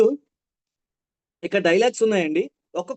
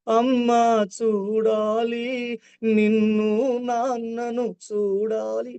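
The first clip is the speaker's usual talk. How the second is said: sad; 5 semitones higher, much slower than usual. Shown as a demonstration. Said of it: "బాధ".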